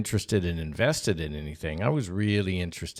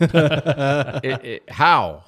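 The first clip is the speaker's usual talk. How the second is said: louder, higher baffled stammer; 4 semitones higher, 7 dB louder, much slower than usual. Retold or imitated. Six-year-old baffled by his dad's gift, stammering disbelief. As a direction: sputter in disbelief. Boy baffled by odd camera.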